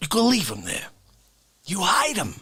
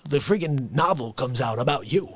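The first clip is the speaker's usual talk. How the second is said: ordinary talk, nothing marked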